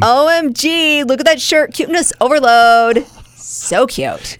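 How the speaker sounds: gushing online voice. Retold or imitated. Mimicking online gushing talk.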